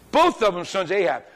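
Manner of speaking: ordinary delivery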